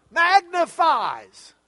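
"magnifies" drawn out slow, high and loud; loud, high, slow stress on "magnifies"; emphatic first word. Shown as a demonstration. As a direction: punch and stretch "magnifies". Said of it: "to put emphasis on certain words".

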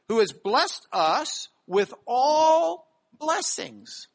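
slow, "all" drawn out and pressed; stress on all. Shown as a demonstration. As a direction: linger on "all blessings". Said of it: "Paul's harping on this word".

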